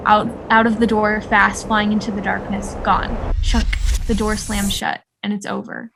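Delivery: usual speaking voice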